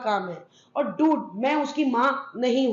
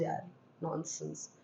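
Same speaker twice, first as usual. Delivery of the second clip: breathy dismissive whisper; whispered aside; 8 semitones lower, 15 dB quieter than usual. Real, not acted. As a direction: toss it off under your breath. (धीमे फुसफुसाकर, झिड़कते हुए)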